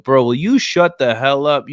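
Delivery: loud, high, playful shout; mock exasperated yell. Friend snapping at him over his love handles, loud mock yell. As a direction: bark it out like a fed-up friend. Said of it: "and you were like".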